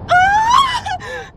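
very high, loud falling scream; terrified scream. Acted. Woman screaming as she falls in her nightmare, terrified. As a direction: scream as you plunge.

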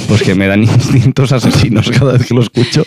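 usual speaking voice

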